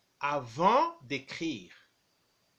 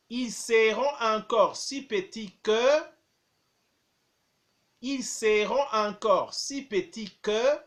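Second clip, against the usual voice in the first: slow, clear dictation, phrase by phrase; dictating; 3 semitones higher, 5 dB louder than usual. Shown as a demonstration. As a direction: dictate each chunk slowly for pupils. Teacher dictating, repeating phrases.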